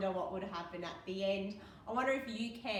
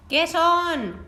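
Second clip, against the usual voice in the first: called out; 8 semitones higher, 19 dB louder than usual. Acted. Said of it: "called the driver".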